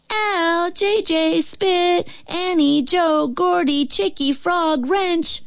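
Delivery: high, drawn-out calls of names; calling for supper. A narrator's reading. Mothers summon their kids in for supper, high calling voice. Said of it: "everyone's mother started calling for supper".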